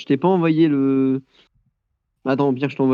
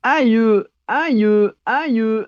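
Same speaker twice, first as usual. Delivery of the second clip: high mock ouch cries; hissing in pain; 8 semitones higher, much slower than usual. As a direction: yelp "aïe" like it hurts. Game hit, mock pain.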